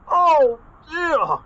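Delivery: high, loud alarmed cry; cried out. Acted. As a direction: cry out in panic. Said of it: "cried Gordon".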